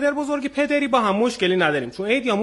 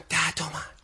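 whisper; 6 semitones lower, 9 dB quieter than usual. Retold or imitated. Boy answers father in story.

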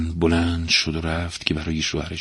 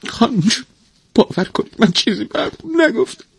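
high, tearful pleading; tearful servant protesting innocence; choked up, near tears. A narrator's reading. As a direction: plead in a high voice with a lump in the throat. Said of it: "لویزا با بغض گفت".

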